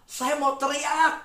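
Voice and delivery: high, drawn-out eager announcement; eager son. Retold or imitated. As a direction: act out a boy itching to yell. Acts out son asking to shout.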